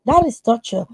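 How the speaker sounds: usual speaking voice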